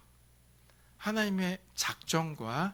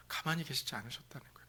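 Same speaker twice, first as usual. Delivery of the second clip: whispered; 3 semitones lower, 12 dB quieter, much faster than usual. Real, not acted. Sermon, dropped voice.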